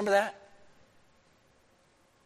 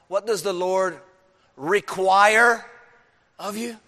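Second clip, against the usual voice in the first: "require" drawn out and punched louder; stress on require; 5 semitones higher, 7 dB louder than usual. Shown as a demonstration. Preacher stressing "require" to the congregation. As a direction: lean hard on "require". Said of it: "I'll emphasize that word".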